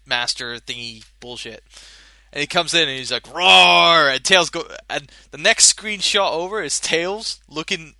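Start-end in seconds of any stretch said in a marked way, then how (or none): 3.3-4.1 loud hammed-up monster roar; mock roar. The time villain bursting into the party, mock roar. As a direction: roar like a cartoon villain arriving.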